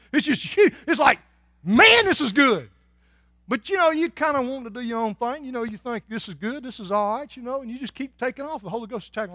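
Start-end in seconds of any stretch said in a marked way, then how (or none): 0.1-2.7 delighted exclaim. Acted. God's vision for you excites. 6.1-7.4 casual shrug. Acted. Wanderer dismissing God's plan, offhand and casual.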